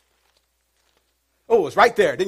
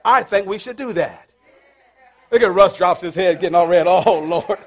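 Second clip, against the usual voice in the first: ordinary delivery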